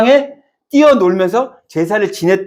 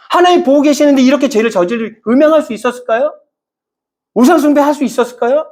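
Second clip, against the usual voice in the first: raised, emphatic sermon climax; fervent preaching; 6 semitones higher than usual. Acted. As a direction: press the point home to the congregation. Preaching at full force.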